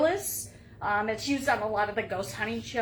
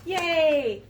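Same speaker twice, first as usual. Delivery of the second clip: excited; 6 semitones higher, 6 dB louder than usual. Real, not acted. Cheer after success.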